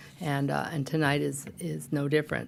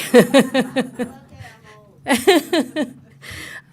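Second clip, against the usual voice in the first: laugh; 10 semitones higher, 8 dB louder than usual. Real, not acted. Host laughs at own punchy joke.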